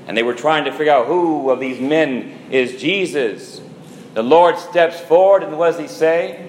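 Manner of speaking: normal talking voice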